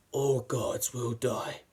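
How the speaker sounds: slow, ominous villain line; menacing god slayer quote. Retold or imitated. Reviewer quoting Gore's opening line, slow and ominous. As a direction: drag it out like a dark vow. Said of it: "And he says".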